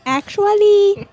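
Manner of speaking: high, slow, know-it-all "actually"; snooty correction. Retold or imitated. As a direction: stretch out "actually" like a pedant. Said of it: "grammar Nazism".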